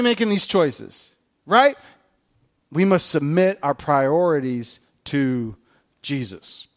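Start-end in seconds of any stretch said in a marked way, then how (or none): none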